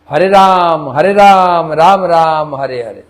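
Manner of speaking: loud chant, রাম closed on m; clipped-ending Ram. Shown as a demonstration. Teacher demonstrates the other version, রাম as loud "Ram". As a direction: hold "raam" with no final vowel. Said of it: "রাম না রাম".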